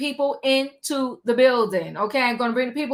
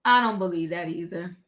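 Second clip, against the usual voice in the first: ordinary talk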